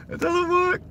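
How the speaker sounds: high, frustrated squeak; exasperated high whine. Real, not acted. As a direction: whine it at the broken mount. Phone mount keeps failing.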